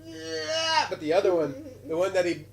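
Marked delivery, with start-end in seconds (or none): 0.0-0.9 put-on child's reply. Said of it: "And then he's".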